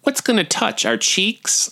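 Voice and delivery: high-pitched imitation of a questioning mother; imitating mom. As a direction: play mom's doubtful question up high. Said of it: "And she said".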